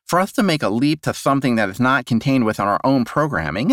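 lightly voiced pedantic officer; lecturing lieutenant. A narrator's reading. Lieutenant Moon lecturing the clone on the law, pedantic. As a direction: argue it like a know-it-all.

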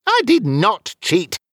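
slow, high, emphatic denial; indignant self-defence; argumentative. Acted. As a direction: argue back, weighting each word. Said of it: "Wizfiddle argued".

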